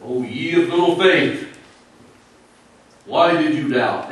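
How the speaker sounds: loud, stern scolding; booming disapproval; scornful rebuke. Shown as a demonstration. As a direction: throw it at him as a punishment. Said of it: "as if it was a scorn or a punishment".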